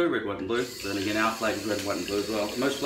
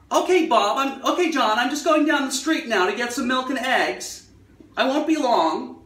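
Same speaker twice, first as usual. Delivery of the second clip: put-on much higher, louder, very crisp old-Hollywood American accent; clear old American accent; 13 semitones higher, 5 dB louder than usual. Showing how Jane Fonda spoke, crisp old-Hollywood American accent. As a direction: enunciate every word in old-Hollywood American. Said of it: "people like Jane Fonda, who spoke like this".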